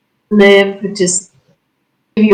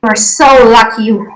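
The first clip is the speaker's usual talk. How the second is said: ordinary delivery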